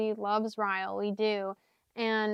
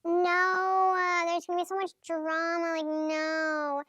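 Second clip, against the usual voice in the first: Evelyn's higher mock refusal; voicing Evelyn; 7 semitones higher, slower than usual. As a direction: play Evelyn brushing off the idea, higher than her own voice. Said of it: "And Evelyn's like".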